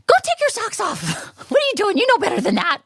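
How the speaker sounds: high, loud imitation of a bossy shop owner; mock scolding yell. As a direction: scold a customer in a hammed-up, high-pitched yell. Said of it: "she tells me, she goes".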